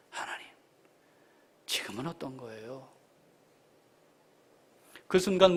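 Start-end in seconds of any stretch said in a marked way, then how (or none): 0.1-2.9 very soft, near-whispered question to God; low, tentative plea; hushed prayer. Acted. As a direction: ask softly, as in private prayer. Acted private question to God.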